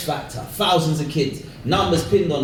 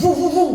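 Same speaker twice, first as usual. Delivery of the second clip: three high, quick whoops; imitated high woo-woo-woo; showy biker whoops; 10 semitones higher, 3 dB louder than usual.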